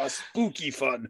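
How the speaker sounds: playful eerie drawl; mock spooky. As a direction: draw it out like a ghost host. Playing up spookiness.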